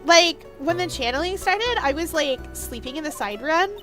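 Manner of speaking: put-on drawling Valley Girl testimony; Valley Girl accent. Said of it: "the return of the Valley Girl accent".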